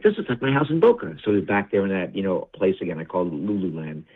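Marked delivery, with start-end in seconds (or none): 0.0-1.2 higher, marveling dad voice; confused father. Retold or imitated. Confused father marveling at his own house, higher voice. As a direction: marvel at it, lifted high.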